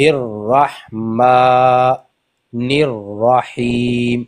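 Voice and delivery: الرحمن الرحیم with the doubled ر held; slow recitation. Shown as a demonstration. Teacher modelling doubled ر, slow. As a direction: slowly hold the ر in الرحمن الرحیم. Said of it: "double amount میں کھینچیں گے".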